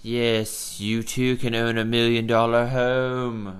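badly faked accent of a luxury TV host; amateur imitation of a showy TV host accent; messed-up host accent. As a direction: put on a bad announcer accent, drawing out the pitch. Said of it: "I'm messing up the accent".

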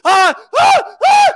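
high, loud shouted ahs; climax cries. Acted. Acting out the hero's first climax in the film scene, loud shouted cries. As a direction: cry out at the peak of the moment. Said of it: "It's like this big orgasmic moment".

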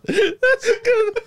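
high, drawn-out words through laughter; wheezing with laughter, high and slow; cracking up. Real, not acted. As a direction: squeal it while helpless with laughter. Cracking up at a joke.

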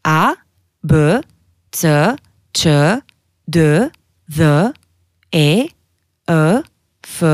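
letters A to Š as sounds, consonants plus eu; letters one by one in Albanian, paused between; Albanian alphabet recital. Shown as a demonstration. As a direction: recite A, B, C as "a, beu, tseu", each apart with a rise. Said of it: "ça commence comme ça".

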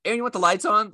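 high, loud patronizing jab; douchey tone. Retold or imitated. Husband needling his wife about the lights, condescending. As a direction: talk down to her, loud and snide. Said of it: "like, in a douchey way".

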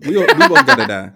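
high, loud, laughing declaration; laughing exclamation. Real, not acted. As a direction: laugh while saying it. Joking about driving through.

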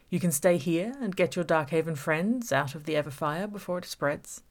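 menacing villain toying with Gabby; villain Sean taunting. Acted. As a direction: savour every word of the trap. Villain Sean's taunting speech.

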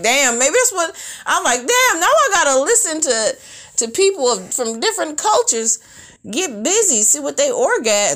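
high, loud exasperated rant; exasperated outburst. Retold or imitated. Telling viewers her reaction to the porn, high and loud. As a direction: blurt it out in disbelief.

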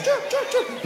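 very high, loud chirps; loud cricket chirps. Acted.

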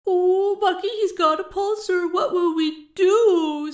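high, loud mock alarm; squealed, sarcastic helplessness; snickering mockery. A narrator's reading. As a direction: tease with a hammed-up fake panic. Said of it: "snickered the smaller of the women".

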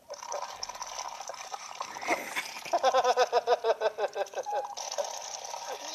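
giggles held back behind the lips; stifled giggles. Real, not acted. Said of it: "Ich wollte nicht lachen, jetzt hab ich gelacht".

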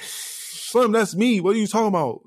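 insisting to the doubting woman that it's his song, exasperated; exasperated protest. Retold or imitated. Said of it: "So I'm like".

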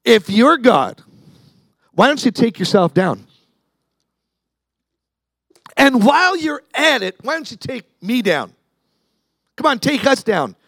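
high-pitched, taunting challenge; mocking. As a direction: jeer at him as if daring him. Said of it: "And made fun of him".

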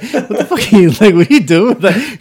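high, loud incredulous laughing quote; astonished roommate. Retold or imitated. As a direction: laugh through his shocked question. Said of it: "He's like".

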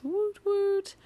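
high squeaky cheer; woot woot cheer. Real, not acted. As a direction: chirp it out way up high. Mock cheer about leaving.

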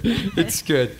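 ordinary delivery

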